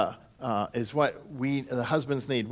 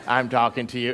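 lightly voiced toddler sidekick; a hint of the Disney sidekick; 3 semitones lower than usual. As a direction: imitate an insistent little cartoon sidekick. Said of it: "It was a Disney sidekick voice".

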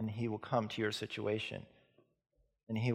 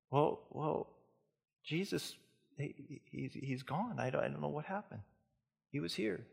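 breathless worried answer, higher and soft; faltering upset Mary, higher and hushed; distraught, higher and softer; 4 semitones higher, 7 dB quieter than usual. Acted. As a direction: stammer it out, lost and scared. Mary's distraught reply.

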